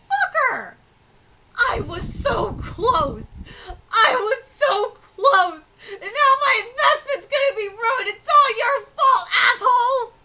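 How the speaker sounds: loud, drawn-out furious rant; pissed off. Acted. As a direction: rage at someone who wrecked your plan. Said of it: "Halfdan is pissed".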